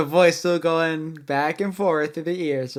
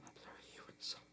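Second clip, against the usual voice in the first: whispered try; 32 dB quieter, much faster than usual. Shown as a demonstration. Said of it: "lot of ways you could possibly phrase that".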